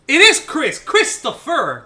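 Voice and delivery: loud, high excited yell of the name; real burst of sudden recall; triumphant shout. Real, not acted. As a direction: blurt it out as it finally comes back.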